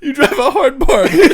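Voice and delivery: loud, high reply through laughter; laughing play-along. Pretend. Friend playing along with the dance deal, laughing loudly. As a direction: crack up while playing the haggler.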